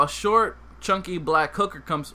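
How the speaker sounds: ordinary talk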